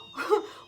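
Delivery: a burst of high, breathless disbelieving laughter; real startled laugh, breathy and high; shocked laugh. Real, not acted. Shocked at the jail news.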